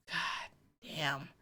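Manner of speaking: breathy whispered god damn after a sigh. Real, not acted. Fan sighing over Abigail's trauma, whispered. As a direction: let it out on a sigh, barely voiced.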